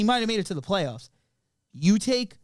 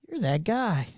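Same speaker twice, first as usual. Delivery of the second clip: imitated Boston local; mock Boston accent. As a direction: put on a Boston accent as a local spotting someone. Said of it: "Oh, the Boston one?".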